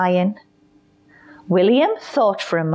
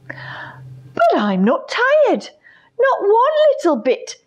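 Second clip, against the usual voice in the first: stubborn lion. Acted. Lion insists not tired.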